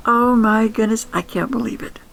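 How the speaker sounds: ordinary delivery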